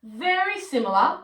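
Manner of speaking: ordinary talk, nothing marked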